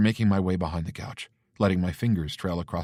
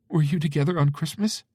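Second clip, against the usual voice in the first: tearful mother; 10 semitones higher, 4 dB louder than usual. Acted. Mother voiced, sniffling.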